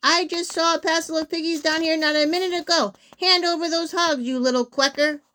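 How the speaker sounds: mildly hammed-up indignation; cross scolding. A narrator's reading. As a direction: berate and make demands. Witch berating the duck.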